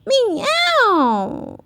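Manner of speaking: high cat meow; purring meow. Acted. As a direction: purr it like a witch's cat. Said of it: "purred Peppa".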